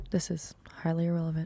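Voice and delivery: fast hushed whisper. Retold or imitated. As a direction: whisper it like a secret. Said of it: "how Alejandro starts off the improv scenes".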